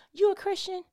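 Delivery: high-pitched, amazed query; astonished question. Acted. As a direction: ask it in mock astonishment. Acting onlookers astonished at a believer.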